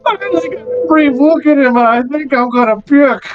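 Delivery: high-pitched, disgusted guard voice; a guard gagging at an ugly face; squeaky guard recoiling in disgust. Acted. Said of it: "one of the guards go".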